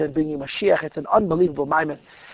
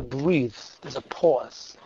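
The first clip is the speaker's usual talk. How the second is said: dramatic hush; 5 semitones lower, 8 dB quieter, much slower than usual. Shown as a demonstration. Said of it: "I'm not very good at drama".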